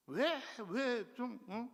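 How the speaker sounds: high, drawn-out whining at God; mock whining prayer; whiny complaint. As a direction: stretch the "왜" like a sulking child. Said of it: "하나님 앞에 불평합니다".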